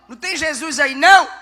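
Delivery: put-on loud call back to the kid; exasperated dad calling out. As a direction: raise it to reach the back seat. Father calling to son in car.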